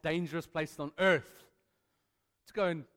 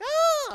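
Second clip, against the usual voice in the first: high, loud mock baby scream; 12 dB louder than usual. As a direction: shriek like a toddler at three in the morning. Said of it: "he's like".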